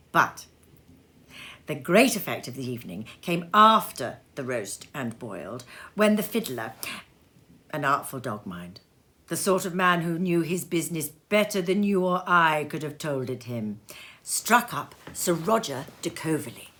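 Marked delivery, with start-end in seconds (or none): none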